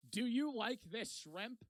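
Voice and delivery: mock slow, deliberate asking; slow, careful question. Friend asking a worker with limited English, slow and careful. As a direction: spell it out slowly for limited English. Simplified question across a language barrier.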